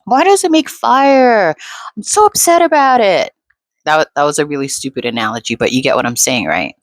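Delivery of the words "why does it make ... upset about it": much higher, whiny mock outrage; hammed-up overreaction, voice up high; mock upset person. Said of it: "people will be like".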